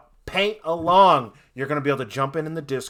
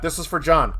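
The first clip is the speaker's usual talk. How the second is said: usual speaking voice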